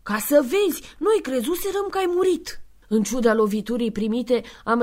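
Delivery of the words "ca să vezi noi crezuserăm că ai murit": high, put-on woman's voice; wife's voice. The blonde wife tells Santa they thought him dead, high voice. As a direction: marvel like a startled housewife.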